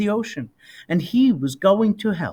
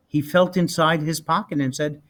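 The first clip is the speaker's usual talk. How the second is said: ordinary delivery, nothing marked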